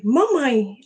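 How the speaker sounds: imitated child's call. Mother retells her son's second call, calling out. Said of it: "e eu ouvi gritando".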